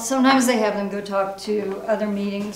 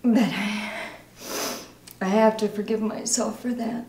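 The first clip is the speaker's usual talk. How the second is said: halting confession, growing distraught; anguished recovering alcoholic; 3 semitones higher than usual. Acted. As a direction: let the guilt build through heavy pauses. Acting exercise, painful confession about daughter.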